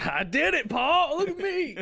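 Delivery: put-on high, proud shout of a child; gleeful kid. Storyteller as his kid self after the shot, gleeful kid.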